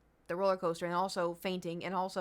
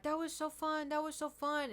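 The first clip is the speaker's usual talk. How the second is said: higher, bright imitation of thrilled friends; gushing friends; 8 semitones higher than usual. Imitating friends gushing about the ride, higher and thrilled. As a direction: gush like the ride was amazing.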